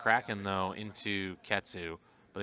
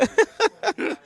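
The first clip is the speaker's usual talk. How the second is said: shrieking high laugh; 10 dB louder than usual. Real, not acted. Laughing hard.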